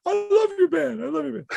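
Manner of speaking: very high, hammed-up pleading; squeaky imitation of a frightened flatterer; mock fawning. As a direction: plead like a cowed heckler, loud then easing off. Cowed heckler flattering the band.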